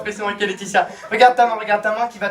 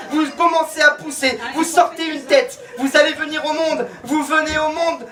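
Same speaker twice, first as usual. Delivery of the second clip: high, driving, urgent commands; rousing stage hypnotist; 6 semitones higher than usual. Acted. Hypnotist urges volunteers to be born, rousing and high-pitched. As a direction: urge them on like a birth coach.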